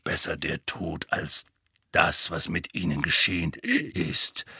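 name the dead low and grim. Acted. Moody showing Harry the old Order, low and grim. Moody continues naming comrades.

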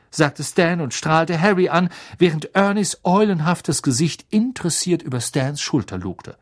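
usual speaking voice

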